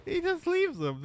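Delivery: high laugh, words still high. Real, not acted. Laughing at the play.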